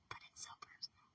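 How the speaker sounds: hushed breathy whisper, barely voiced; soft whisper, almost to herself. Real, not acted. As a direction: drop to a whisper on the admission. Whispered contrast with not personal.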